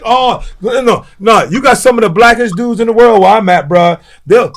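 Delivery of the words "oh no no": usual speaking voice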